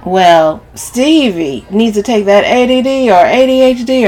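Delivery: fed-up cousin venting; brash, exasperated Camille, ranting. A narrator's reading. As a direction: let the complaint pour out loudly.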